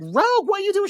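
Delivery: high-pitched incredulous question; mock alarm. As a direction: shoot up high as if scandalized. Voices alarm at Rogue.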